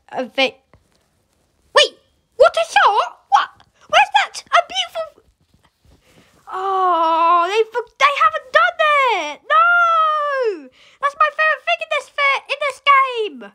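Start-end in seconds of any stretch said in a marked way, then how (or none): 1.7-5.2 very high excited squeal; amazed. Real, not acted. Amazed by a shot in game. 6.5-13.4 dismayed wail. Real, not acted. Dismayed favourite thing is missing.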